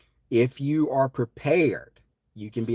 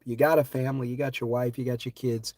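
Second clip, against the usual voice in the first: usual speaking voice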